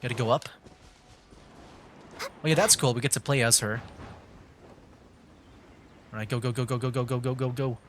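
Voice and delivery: ordinary delivery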